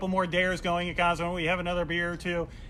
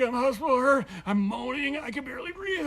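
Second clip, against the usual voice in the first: higher, strained inner voice; hammed-up hurt whine; mock pained moan; 8 semitones higher, 3 dB louder than usual. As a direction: whimper it like you're badly hurt. Inner voice in pain.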